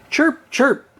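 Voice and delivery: high, light chirping like a little bird. A narrator's reading. Birds singing in a tree.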